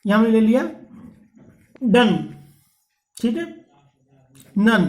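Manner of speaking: normal talking voice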